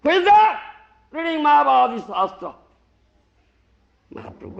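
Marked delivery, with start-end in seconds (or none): 0.0-2.6 loud, high angry challenge; imitating Mahaprabhu's angry shout. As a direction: demand who is reading it, loud and high. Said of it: "got angry".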